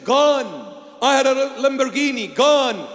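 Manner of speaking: ordinary talk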